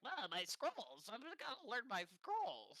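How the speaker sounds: high, slow, whiny nerd wizard; mock a scroll-obsessed nerd; nerdy wizard voice. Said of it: "wizards are like".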